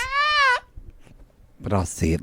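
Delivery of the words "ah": high-pitched mock scream. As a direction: re-enact a frightened little shriek. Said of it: "I was like".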